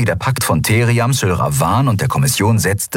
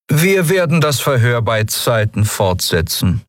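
higher, composed official voice; cool interrogator; 5 semitones higher than usual. A narrator's reading. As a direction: announce it as a formal commodore. Commodore addresses his prisoner.

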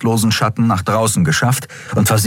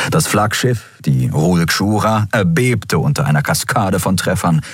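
usual speaking voice

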